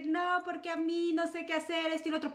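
soft, high-pitched imitation of weeping; mock crying. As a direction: act out a helpless whimper. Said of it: "Llorando así de que". (quejido agudo, fingiendo llorar)

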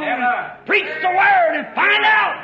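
slow, high, loud exhortation; shouted preaching command. Retold or imitated. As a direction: belt out each word like a charge. Preacher voices a rousing call.

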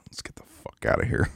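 low, hushed hot-mic mutter; soft, unvoiced sign-off grumble; imitated off-air anchor. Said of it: "Oh, the news anchor".

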